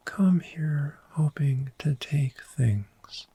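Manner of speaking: ordinary talk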